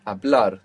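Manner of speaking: "Hablar" stressed on BLAR; stress on the end. Shown as a demonstration. Teacher echoing student's answer, last syllable stressed in "Hablar". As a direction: land on the final syllable of "Hablar". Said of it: "accent is coming on the end".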